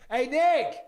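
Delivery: high, loud holler of a name; calling out. Real, not acted. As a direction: shout to get someone's attention from afar. Calling Nick from afar.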